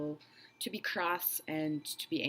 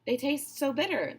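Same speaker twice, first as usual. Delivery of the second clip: higher, louder voice of the not so big crocodile; smaller crocodile insisting; 9 semitones higher, 6 dB louder than usual. Acted. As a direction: protest that children taste bad. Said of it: "the not so big one said".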